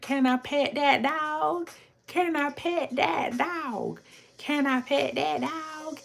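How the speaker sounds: put-on high, slow country drawl of a toddler; little girl's country voice. Streamer imitates the TikTok toddler seeing a bear, high country voice. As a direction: voice a tiny girl with a country accent, drawn out. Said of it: "country voice".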